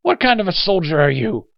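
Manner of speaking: high, loud angry challenge; snapped. Acted. Old man scorns a young soldier, snapping angrily. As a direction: snap it as a scornful rebuke. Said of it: "he snapped".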